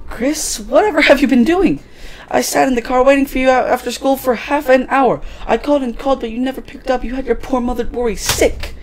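high-pitched fussing mother voice; worried, scolding mother. Acted. Mother to her child who never picked up, high worried scolding.

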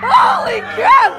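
scream. Real, not acted. Scare actor in haunted house.

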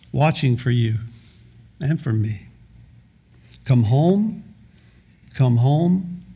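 hymn recitation. Acted. Preacher reciting an old hymn to the congregation, hushed. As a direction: recite it gently, letting each line hang.

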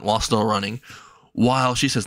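usual speaking voice

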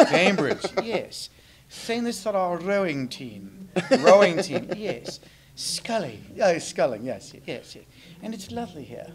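prim English gentleman puppet, preening; British puppet. Pretend. Holds British puppet character.

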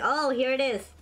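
normal talking voice

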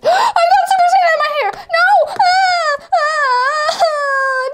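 high panicked screams; panicked screaming. Acted. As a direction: shriek in panic. Character gets sand in hair.

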